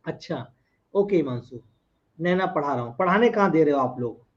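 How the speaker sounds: normal talking voice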